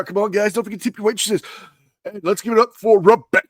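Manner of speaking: club DJ patter building to a stretched-out name. Acted. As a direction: work the room like an overhyped DJ. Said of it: "I am D J N C R".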